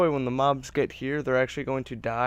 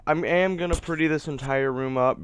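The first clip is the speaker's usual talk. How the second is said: ordinary delivery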